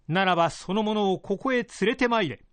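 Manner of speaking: feudal lord voice. Acted. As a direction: speak as a pleased old lord. Lord gives an order.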